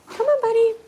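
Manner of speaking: high come-on to a pet; coaxing pet talk. Real, not acted. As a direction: call the dog sweetly. Calling her pet.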